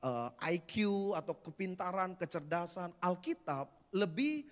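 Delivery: full-voiced preaching. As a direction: preach it to the congregation.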